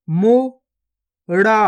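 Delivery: गुफा drawn out very slowly, each sound held long; slow stretched reading. Shown as a demonstration. Teacher showing pupils each sound, drawn out very slowly. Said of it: "पहले तो आपको शब्द को गौर से सुनना है".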